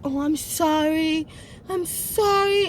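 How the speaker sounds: slow, drawn-out retold sorry; mock apology.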